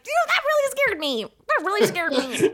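high, laughing fake-casual voice; playing it cool. Teen girl telling boyfriend she's fine, high fake-casual laugh. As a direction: pretend you're fine while laughing. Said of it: "playing, like".

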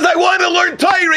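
high-pitched, impassioned insistence; insistent plea. Acted. Son insists to his father after being refused, high impassioned plea. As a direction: plead it high, refusing to give up.

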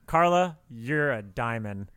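ordinary talk

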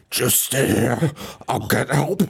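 slow, teary mock plea; mock crying. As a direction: whimper it like a dying man. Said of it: "and he's like".

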